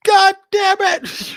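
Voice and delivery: drawn-out furious yell, high and loud; angry yell. Retold or imitated. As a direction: bellow it out slowly. Imitating a panicked parent.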